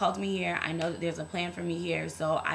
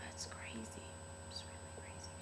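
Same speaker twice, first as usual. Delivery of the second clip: whispered aside to viewers; 5 semitones higher, 16 dB quieter than usual. Real, not acted. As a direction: drop into a secret whisper. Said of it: "drops to a whisper".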